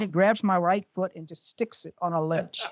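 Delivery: ordinary talk, nothing marked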